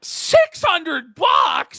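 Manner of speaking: slow, drawn-out disbelief; shocked. Real, not acted. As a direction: stretch it out in disbelief. Shocked at cameo price.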